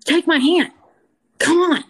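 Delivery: pleading with the youngest sister to leave, urgent and loud. Retold or imitated. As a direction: reach out and coax her to follow. Urging her young sister to leave.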